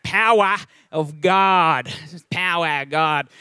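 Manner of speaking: drawn out slowly, broad Australian "Power of God"; Australian accent. Shown as a demonstration. Pastor testing his Australian accent on the church. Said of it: "getting my Australian accent back".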